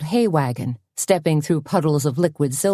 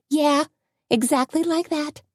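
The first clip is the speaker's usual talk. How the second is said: higher, lightly voiced friend; a higher lilt for Tony; Tony's voice; 11 semitones higher than usual. A narrator's reading.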